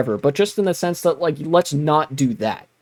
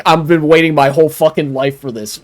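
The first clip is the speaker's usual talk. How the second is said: loud gleeful exclamation; excited outburst; 7 dB louder than usual. Pretend. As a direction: blurt it out with glee. Imagined future self bragging.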